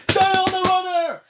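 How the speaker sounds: high, loud coach yell; shouting. Retold or imitated. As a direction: yell it like a kid at football. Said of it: "he keeps shouting things like".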